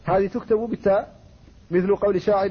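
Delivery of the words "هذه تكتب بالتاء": usual speaking voice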